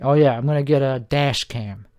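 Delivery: ordinary delivery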